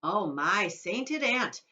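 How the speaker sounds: slow, drawn-out dismay; crying out in dismay. Acted. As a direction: stretch it out in mock horror. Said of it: "cried Mister Wonka".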